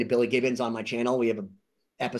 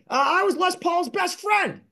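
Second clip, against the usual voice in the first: quoted old friend, voiced much higher; imitating Jimmy; 14 semitones higher than usual. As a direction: proudly announce it in his voice, pitched up. Retelling meeting Jimmy, quoting him.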